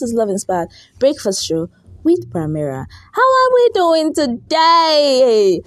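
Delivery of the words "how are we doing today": drawn-out, slow, loud radio greeting; hyped greeting. Acted. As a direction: stretch it out like a morning DJ. Host greets listeners.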